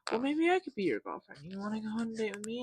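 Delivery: put-on girl's voice. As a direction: lift the pitch and rattle it off. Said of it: "She was like".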